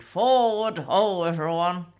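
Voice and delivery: big mock-heroic shout; rallying cry. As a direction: call the troops onward, slow and loud. Rallying call to everyone.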